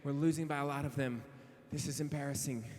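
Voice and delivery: ordinary talk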